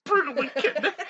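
high, loud laughing repeat; cracking up. Real, not acted. Reader losing it over the story, cracking up. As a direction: squeal it through helpless laughter.

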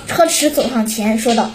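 ordinary delivery, nothing marked

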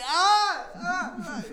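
high, loud forced laugh; fake laugh. Shown as a demonstration. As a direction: try to fake a hysterical laugh. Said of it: "Ich kann kein Lachen imitieren".

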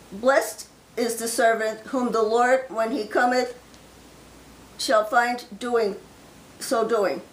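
slow, solemn King James reading; scripture reading. Acted. Preacher reading scripture to his online flock, slow and solemn. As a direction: read it out like a preacher from the pulpit.

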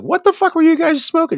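very high, loud mock disbelief; retold squawk of bewilderment; incredulous shout. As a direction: yell it in baffled outrage. Retold incredulous shout at stoned friends.

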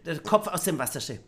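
ordinary delivery, nothing marked

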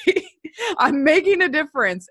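laughing. Real, not acted. Laughs while telling her joy.

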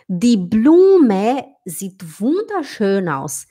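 very slow, even German sentence; careful classroom reading; slow example. Shown as a demonstration. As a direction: read it out slowly for students. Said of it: "example sentence read".

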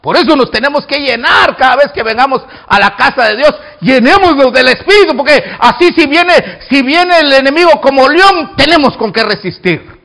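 rolling, full-force sermon; fervent preaching. Real, not acted. Preacher urging church to fill with the Spirit, fervent. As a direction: build to a hallelujah climax.

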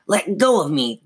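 high, snarling puppet voice; evil dummy demand. A narrator's reading. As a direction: demand it like an angry living dummy. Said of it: "the dummy repeated".

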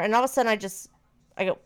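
ordinary talk, nothing marked

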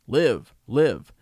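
loud, falling bird-like calls; raven's cry. A narrator's reading. Raven flying to the commander's shoulder, crying out.

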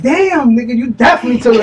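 high, loud teasing exclamation; mock astonishment. Teasing a friend about their story, loud mock astonishment. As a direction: blurt it out fast and amazed.

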